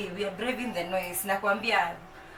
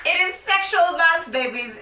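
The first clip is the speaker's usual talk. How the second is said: higher and louder, a giddy announcement; loud excited announcement; 9 semitones higher, 7 dB louder than usual. Shown as a demonstration. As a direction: shout it out with glee. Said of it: "I'm sure you can guess".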